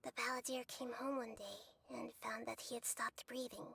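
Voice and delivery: soft, whispered grief; a quiet, sorrowful whisper; hushed whisper. Acted. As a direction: whisper the sad ending. Narrating child's death.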